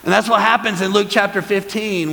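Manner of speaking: high, loud, rousing sermon delivery; full-force preaching. Real, not acted. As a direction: build excitement and proclaim it to the whole church.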